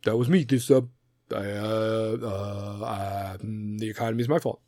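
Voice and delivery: mock statesman taking credit haltingly; Obama. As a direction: hesitate with long low "uh"s. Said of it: "Obama's like".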